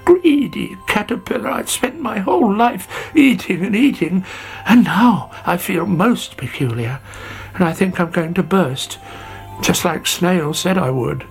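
high woeful caterpillar; a touch of tearful self-pity; miserable lament. A narrator's reading. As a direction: moan about your greed, tearful. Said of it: "cried Sammy".

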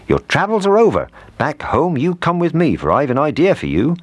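high, lifted character voice; baker's hearty rescue. A narrator's reading. Baker picking up the gingerbread man, high cheerful voice. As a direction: announce it brightly to a little friend.

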